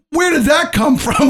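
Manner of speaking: high, drawn-out incredulous cry; retold disbelief. Retelling own startled reaction.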